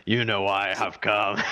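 lightly gravelly Viking berserker, laughing; a hint of a menacing Viking. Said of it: "Can you do a gravelier Viking berserker voice".

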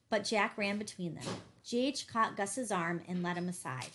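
usual speaking voice

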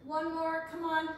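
ordinary delivery

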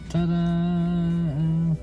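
ordinary talk

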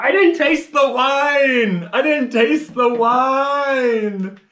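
high, loud, slow cries of dismay; anguished yelling. Real, not acted. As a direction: wail it out in frustration. Wrong guess, shot again.

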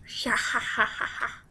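slow, breathy villain cackle; evil laugh. Pretend. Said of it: "That's my evil laugh".

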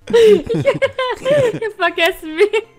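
loud, high, slow words through laughter; helpless laughter. Real, not acted. Player admits she forgot the name, laughing helplessly. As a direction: squeeze the words out while cracking up.

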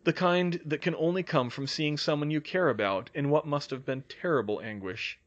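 ordinary delivery, nothing marked

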